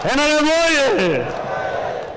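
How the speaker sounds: high drawn-out crowd call; rally cry. Real, not acted.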